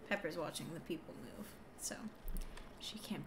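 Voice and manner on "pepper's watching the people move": normal talking voice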